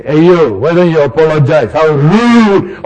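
indignant shopper scolding a stranger; loud indignant complaint. Retold or imitated. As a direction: demand an apology loudly. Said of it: "you say".

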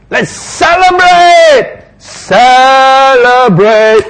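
father shouting with joy, high and loud; joyful father calling. Acted. Father calling the household to celebrate, a joyful shout. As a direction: throw the party open, loud and high. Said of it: "and says".

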